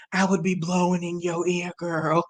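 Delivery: hammed-up deep boyfriend voice; mock low smooth-talking boyfriend. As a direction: croon it low like a teen playing grown man. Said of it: "you know".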